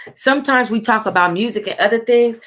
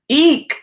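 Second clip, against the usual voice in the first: short high squeal of alarm; startled squeal; 8 semitones higher, 5 dB louder than usual. Real, not acted. Reader jumps at something and squeals. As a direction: shriek it, caught off guard. Startled outburst while reading.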